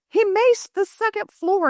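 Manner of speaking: high, slow mock wail; retold frantic holler. Mom reports the macing to husband, high mock holler. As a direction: act out calling the disaster out to someone.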